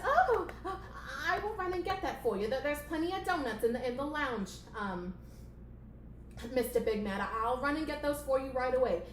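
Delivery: high, hurried servile voice; eager-to-please puppet assistant; flustered assistant. Acted. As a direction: apologise in a high, flustered stammer. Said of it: "playing the assistant character".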